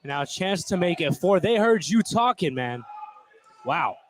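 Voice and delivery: ordinary delivery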